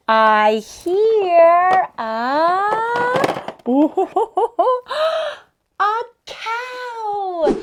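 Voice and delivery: high, loud excited reveal; big excited discovery, drawn out. Acted.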